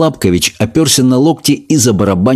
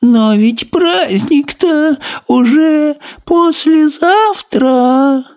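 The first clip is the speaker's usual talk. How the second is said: very high, slow whiny snail voice; tearful snail; much slower than usual. A narrator's reading. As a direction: wail it like a tearful little snail. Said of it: "плаксиво воскликнула улитка".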